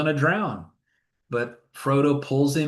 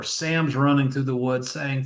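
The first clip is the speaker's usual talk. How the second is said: ordinary talk, nothing marked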